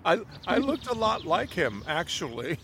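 high, loud words through laughter; laughing admission. Acted. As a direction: confess it while laughing. Laughing about looking like teacher.

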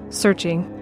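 ordinary talk, nothing marked

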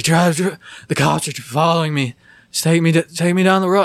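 put-on high, loud, frantic drunk pleading; drunk guy. Drunk guy in my car orders me to drive, imitated frantic yell. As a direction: imitate a panicked drunk demanding a ride. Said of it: "The drunk guy, whatever he's on".

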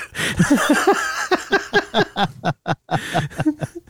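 high, rolling burst of laughter; long laugh. Real, not acted. Friends hearing how the guy just left, long high laugh. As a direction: crack up at the punchline. Listeners laugh at story's punchline.

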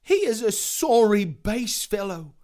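high, loud disdainful character voice; haughty exclaiming juror. Acted. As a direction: exclaim in scorn, pitched well up. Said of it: "exclaimed Mister High Mind".

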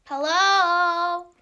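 loud, high, drawn-out hello; exaggerated yell. Shown as a demonstration. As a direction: belt out a long hello in mock compliance. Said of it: "I wasn't asking you to yell or make a influx with your voice".

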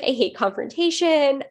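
mild mock upset; imitating upset friend. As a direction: retell a sweet friend's tearful protest, no real crying. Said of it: "my friend was crying".

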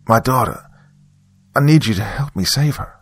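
desperate plea. A narrator's reading. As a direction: plead as a desperate mother. Desperate mother pleads for help.

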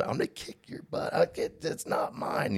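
imitated angry whisper; upset muttered threat. Upset buddy facing jail alone, whispering threats. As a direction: threaten your buddies under your breath. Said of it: "he's upset thinking he's gonna be".

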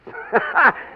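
high gleeful burst of laughter. Acted. King imagining brother Michael's face, gleeful laugh. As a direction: laugh with delight at the prank.

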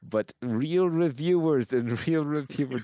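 hammed-up accent, drawing the words out; Tom Hanks movie accent. Impressionist speaking for real reviewers, exaggerated put-on accent. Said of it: "Tom Hanks doesn't know what that accent is".

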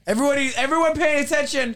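mock-hush the whole class, hurried and high; calling for attention. Calling the class to attention for teacher, mock urgent.

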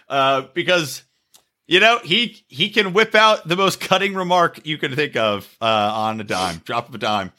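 normal talking voice

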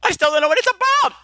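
high, fast mock scolding; mock yell. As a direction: yell at him, all in fun. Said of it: "I'm gonna yell at you anyway".

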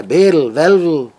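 loud call to Berl and Welwel; calling out names. Retold or imitated. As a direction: call the names across the house.

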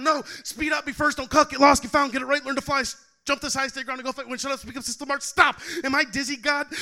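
shouted list of demands in the poem; rapid-fire commands. Acted.

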